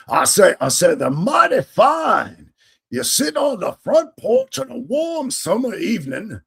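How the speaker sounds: high, loud, drawn-out Southern plantation colonel; terrible Southern accent. Acted. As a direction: ham up a cartoon Southern gentleman drawl. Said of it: "That's just a terrible accent".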